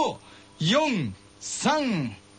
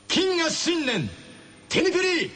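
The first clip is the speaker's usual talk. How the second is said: announcer; 7 semitones higher, slower than usual. Acted. Announcing tonight's contest.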